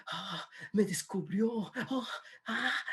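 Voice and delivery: mock gasps of shame, climbing in pitch; caught out, embarrassed. Verónica caught doing wrong, acting mortified. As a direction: act softly aghast at being found out. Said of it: "¿Cómo se sentirá Verónica?".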